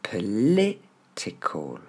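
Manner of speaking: POLITICAL as "pe-LÉ... té-col", very slowly; stretched-out "political" for learners; E sound for I. Shown as a demonstration. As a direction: break "political" after the stressed LI. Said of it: "nos deux sons E pour la lettre I".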